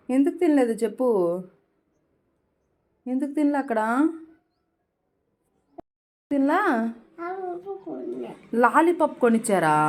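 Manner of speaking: mother quizzing her small daughter, high and loud. Real, not acted. Mother questions daughter about school.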